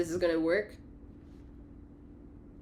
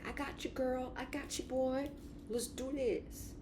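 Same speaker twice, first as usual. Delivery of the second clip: high soft ego; 8 semitones higher, 8 dB quieter than usual. Acted. Ego agreeing with intuition, high and soft. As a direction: promise it gently, eager to help. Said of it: "your ego is like".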